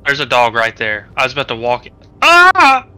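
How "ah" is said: panicking gamer. Real, not acted. Dog appears, jump scare.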